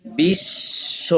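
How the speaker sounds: বিশ্ব very slow, শ held double as বিশ্-শ; doubled sh. Shown as a demonstration. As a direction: drag out বিশ্ব with a long doubled শ. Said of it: "শকে দুইবার উচ্চারণ করাইছে". (বিশ্ব, শ দুইবার টেনে ধীরে)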